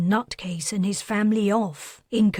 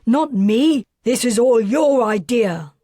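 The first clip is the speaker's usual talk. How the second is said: throwing it back at his wife; angry quarrel; 2 semitones higher, 6 dB louder than usual. Acted. Father argues with wife.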